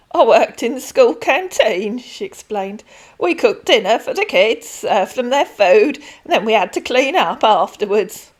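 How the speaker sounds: a kindly pensioner rambling on; old lady Denise. Acted. Voices Denise.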